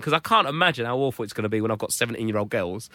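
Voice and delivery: ordinary talk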